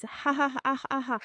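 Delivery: high, loud imitation kookaburra cackle; kookaburra laugh. Shown as a demonstration. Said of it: "The kookaburra says".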